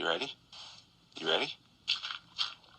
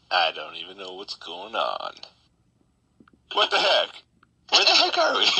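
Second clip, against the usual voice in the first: ordinary talk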